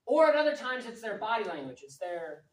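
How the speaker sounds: usual speaking voice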